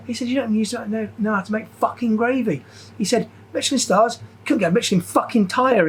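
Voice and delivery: mocking head chef. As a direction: ham up the boss tearing into you. Imitating head chef berating him.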